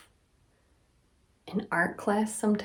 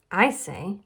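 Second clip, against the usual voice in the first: ordinary delivery, nothing marked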